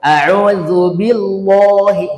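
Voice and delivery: slow "A'udzu billahi" with dark "lo"; heavy wrong reading. Shown as a demonstration. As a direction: model the error slowly. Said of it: "enggak, billahi".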